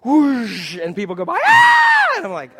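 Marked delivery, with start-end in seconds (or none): 0.0-0.8 loud, drawn-out imitated whoosh; vocal whoosh sound effect; whooshing ride noise. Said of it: "so you hear this whoosh". 1.4-2.1 very high, loud mock shriek; piercing acted coaster scream; imitated riders' scream.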